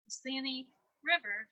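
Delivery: ordinary delivery, nothing marked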